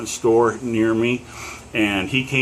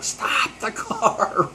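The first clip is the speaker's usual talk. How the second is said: drawn-out imitated yell, higher pitch; mock yell; 12 semitones higher, much slower than usual. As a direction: stretch it out like hollering, without full volume. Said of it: "really loud".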